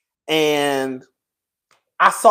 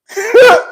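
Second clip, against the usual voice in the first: high, loud laugh at herself; burst of laughter; 13 semitones higher, 7 dB louder than usual. Real, not acted. Laughing at being a crybaby.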